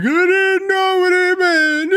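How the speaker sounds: high, drawn-out wail imitating a kid; hammed-up tearful whine, far above own voice; mock crying kid. Seven-year-old pleading to his coach, mock crying. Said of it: "this kid starts tearing up".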